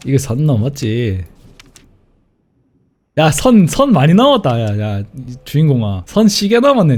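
ordinary delivery

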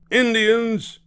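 high, loud warning cry; alarmed shout. A narrator's reading. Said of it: "shouted Cowboy Charlie".

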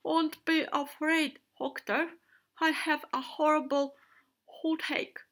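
high, loud toothache complaint; babbling shark. A narrator's reading. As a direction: complain loudly about a toothache. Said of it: "Shark babbled".

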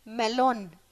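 a wizard calmly speaking the password to open the door; clear spell word. A narrator's reading. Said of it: "spuse cu voce limpede".

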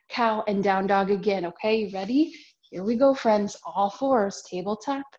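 ordinary talk

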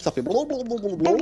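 high burbling blou sounds; water-in-mouth babble. Retold or imitated. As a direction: imitate talking with water in the mouth. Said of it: "quand tu parles avec de l'eau dans la bouche".

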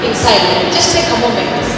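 quiet, hushed prayer leading; soft prayer voice. Acted. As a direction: gently lead a moment of silent prayer. Leads a silent prayer moment.

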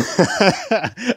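high, loud giggle; laugh. Real, not acted. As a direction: crack up at your own story. Cracks up at own story.

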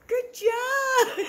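high laughing cheer; excited praise. Real, not acted.

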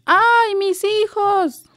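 high, loud, slow wail; a dead mother's soul crying through the air, loud and drawn out; screaming wail. A narrator's reading. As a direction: let the ghost cry out long across the wind.

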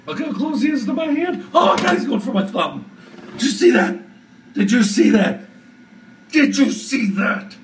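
high frightened exclaiming; high panicked. Real, not acted. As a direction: panic as it nears your hand. The spider near her hand.

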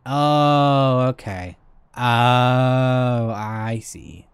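ordinary talk

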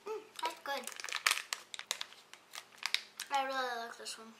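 speaking between chews; chewing while talking. Real, not acted. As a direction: mouth partly full of chocolate. Seen 0: bites bar at -3, closed working mouth while speaking after.

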